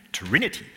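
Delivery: Trinity said shorter, pitch jumping up late in the word; quick Trinity with a rising end; shifted syllable stress. Shown as a demonstration. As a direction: lift the later part of Trinity. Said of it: "Which syllable do you emphasize?".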